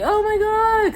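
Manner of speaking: high, loud fangirl squeal; starstruck squeal. Retold or imitated. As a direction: shriek like a starstruck fan. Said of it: "And I was like".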